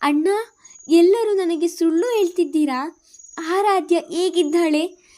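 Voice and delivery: high, loud, slowing desperate demand; distraught. Acted. Sister demanding the truth about her baby, distraught and loud. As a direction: confront them, voice breaking out. She discovers they lied.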